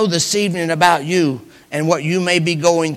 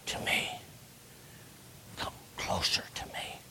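tender whisper; 20 dB quieter than usual. Acted. Joseph calling his brothers close, in a whisper.

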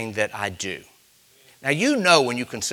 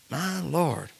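ordinary talk

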